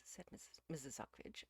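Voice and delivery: usual speaking voice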